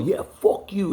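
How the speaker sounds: high mocking voice of the uncle. Mimicking the uncle's curse at the speaker. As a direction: throw his curse back at him, mocking. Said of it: "He's all".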